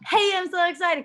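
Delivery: high, loud, put-on cheer; mock a forced happy post; fake excitement. Faking joy on a bad day, mock bubbly. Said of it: "I'm not gonna try to be like".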